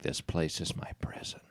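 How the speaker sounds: put-on hushed whisper of a grim old woman; whispered Nana. Grandmother complaining about her new place, whispered. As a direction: whisper it like a gloomy old lady. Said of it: "she's like".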